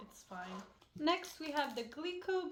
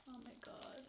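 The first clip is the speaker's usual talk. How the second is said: hushed, breathless whisper; 12 dB quieter than usual. Real, not acted. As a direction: whisper it in awe. Whispers before the Kit Kat.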